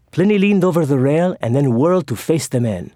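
ordinary delivery, nothing marked

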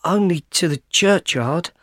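usual speaking voice

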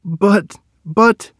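stuck for words; panicked stammer. A narrator's reading. Erman stammers, no coins left.

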